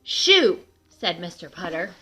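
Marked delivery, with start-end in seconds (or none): 0.0-0.6 sharp, higher, louder shoo; loud shoo at a squirrel; shooing call. A narrator's reading. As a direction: chase the squirrel off the rail.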